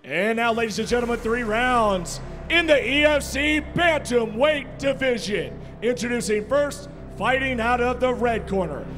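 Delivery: big booming fight-night introductions; boxing ring announcer. Acted. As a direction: stretch the names like a ring announcer.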